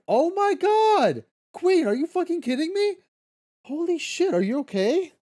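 sudden high gasp of disbelief; floored by the news, voice jumps way up; shocked. Real, not acted. Streamer reacts to chat's gallstones news.

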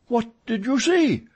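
high, loud eager question; high-pitched excited query; urgent astonishment. A narrator's reading. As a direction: demand it, agog. Startled manager demands the sighting.

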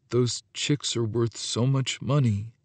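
very quiet, subdued admission; almost a whisper. A narrator's reading. Antonio confessing with slumped shoulders, almost whispering. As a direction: murmur it softly, near whispering. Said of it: "almost a whisper".

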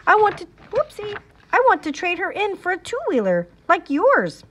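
put-on high, fast excited child. A narrator's reading. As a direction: blurt it out eagerly. Excited small child blurting.

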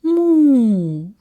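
cow moo. A narrator's reading. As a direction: draw out a storybook cow's moo. Cow moos before speaking.

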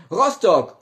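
high, loud shout. Acted. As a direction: yell the city name. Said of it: "warum ich so schreie immer".

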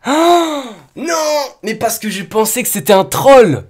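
high-pitched protest; dismayed realisation. Real, not acted. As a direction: wail it high, caught out. Realises he has trolled himself.

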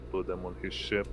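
normal talking voice

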